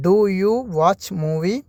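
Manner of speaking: very slow question with a rising end; slow teaching model. Shown as a demonstration. Teacher modelling a question for students, very slow. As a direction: draw each word out for beginners. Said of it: "അതെങ്ങനെയാണ് നമ്മൾ ചോദിക്കുക?".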